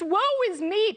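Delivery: high, mildly hammed-up lament; mock self-pity. Host ridicules teachers' excuses, in mock self-pity. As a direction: toss it out as a mock wail. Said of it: "All the ridiculous".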